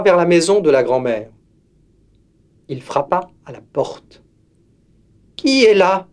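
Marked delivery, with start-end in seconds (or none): 5.3-6.1 put-on higher, louder old woman's call; grandmother calling. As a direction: call out to the door. Voice answering the knock.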